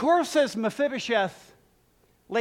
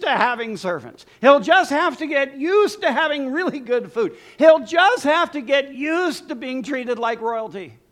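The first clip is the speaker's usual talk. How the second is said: mock pity; 5 semitones higher, 5 dB louder than usual. Real, not acted. Ironic build about palace life.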